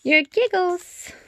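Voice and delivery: high playful voice for the giggles. Acted. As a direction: lift it up like a giggle.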